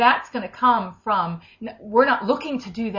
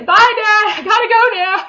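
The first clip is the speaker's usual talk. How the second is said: much higher, louder, playful laughing farewell; cheerful goodbye; 13 semitones higher, 10 dB louder than usual. Pretend. Lizzie waving to the Father, playful laughing goodbye. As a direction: wave off to Daddy lightly. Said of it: "wave and say".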